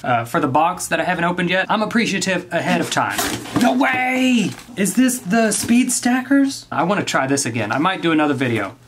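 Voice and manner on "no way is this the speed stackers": high delighted gasp of surprise; genuinely thrilled to find it; surprised. Real, not acted. Opening gift, recognizes speed stackers.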